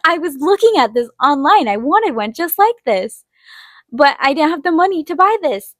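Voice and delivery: retold thrilled outburst; excited disbelief. Retold reaction to free easel.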